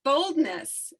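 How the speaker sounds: normal talking voice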